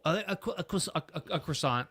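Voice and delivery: a cro-, a cro- stammered before a croissant; mock customer tripping over croissant; fumbled croissant. Customer fumbling the word for the barista: light stammer. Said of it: "the second time they'd always fumble".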